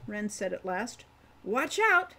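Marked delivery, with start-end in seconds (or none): 1.4-2.1 high, loud warning shout; calling out. A narrator's reading. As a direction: yell a warning as she leaps down. Wren calls out before jumping.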